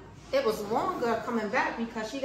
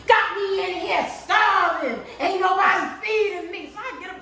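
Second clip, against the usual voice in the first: ordinary talk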